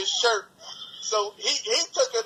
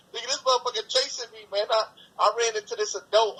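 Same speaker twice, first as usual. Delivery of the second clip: ordinary delivery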